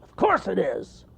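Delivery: high, loud proud bear; smug cartoon bear. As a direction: answer with pride. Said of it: "said the bear proudly".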